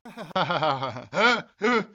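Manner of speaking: a gloating cackle; a short, delighted scheming laugh; gleeful laugh. Real, not acted. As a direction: laugh like the scheme just paid off. Gloating over the tribute scheme.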